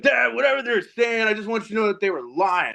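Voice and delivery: a hint of a nervous kid; lightly voiced kid. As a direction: play the guilty son getting ahead of it. Son rushing in, defending himself.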